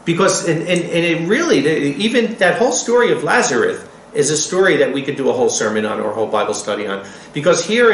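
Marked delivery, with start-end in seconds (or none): none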